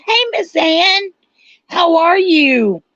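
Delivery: high, drawn-out greeting with a voice crack. Real, not acted. Streamer greeting Miss Ann in chat, voice cracking high. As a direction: let the voice break upward on a slow hello. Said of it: "my voice cracked when I went to say".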